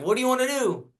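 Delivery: high, bright announcer voice lifted well up; host's question. Retold or imitated. Host asking what record comes next, pitched high. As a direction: voice the host pumping up the crowd.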